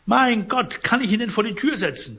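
high, loud fussy protest; concierge voice. Acted. Concierge protests to Pons, loud and fussy. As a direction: act the flustered concierge.

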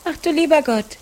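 dismayed cry. A narrator's reading. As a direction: cry out in shock at the flood. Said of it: "rief Maren".

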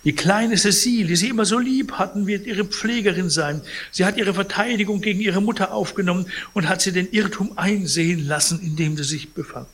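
high, fast, smooth persuading voice; oily lawyer selling an offer to the patient; unctuous lawyer. Acted. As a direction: sweet-talk the sick old man quickly.

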